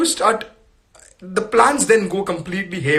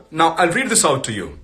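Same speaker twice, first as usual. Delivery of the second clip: ordinary delivery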